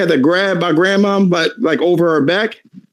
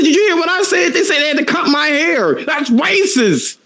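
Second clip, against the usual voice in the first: much higher, outraged complaint; indignant younger self; 7 semitones higher than usual. Retold or imitated. Son to his mom, high indignant protest.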